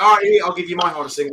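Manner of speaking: mock challenge. Retold challenge handing over chili.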